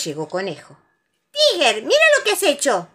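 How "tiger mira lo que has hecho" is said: high, loud telling-off; angry shout. A narrator's reading. Conejo to Tiger over wrecked house, angry shout. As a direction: shout it in indignation. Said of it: "gritó Conejo enojado".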